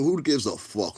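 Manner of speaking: normal talking voice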